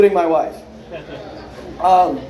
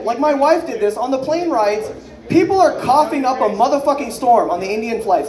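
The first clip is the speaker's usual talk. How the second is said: usual speaking voice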